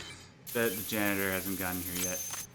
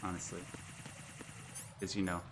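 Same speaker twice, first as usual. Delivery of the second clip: ordinary delivery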